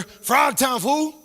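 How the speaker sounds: loud, high, drawn-out gang call. Acted. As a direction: croak a gang challenge like a frog. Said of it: "what they were saying on the other side".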